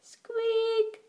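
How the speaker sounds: soft, very high squeak; mouse squeak. A narrator's reading. Mouse answers Duck's hello, squeaking softly. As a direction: squeak thinly like a tiny mouse. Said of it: "said Mouse".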